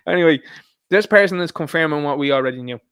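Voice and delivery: ordinary talk, nothing marked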